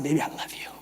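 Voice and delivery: soft, intimate whisper. Retold or imitated. Husband with his arm around his wife, whispering. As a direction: breathe it into her ear. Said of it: "Last night I whispered to her".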